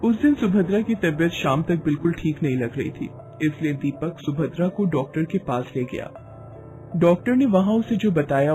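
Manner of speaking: ordinary delivery, nothing marked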